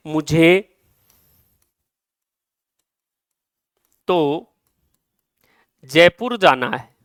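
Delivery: मुझे stretched and set apart, then a long pause before तो; slow, spaced delivery with मुझे held out; stress on मुझे. Shown as a demonstration. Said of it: "इस मुझे पर जोर देने के लिए". (मुझे पर ज़ोर, रुक-रुक कर)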